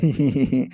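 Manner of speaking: short low chuckle; laughing. Acted. Hero laughs at the rich rival, short low chuckle. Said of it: "हंसने लगता है".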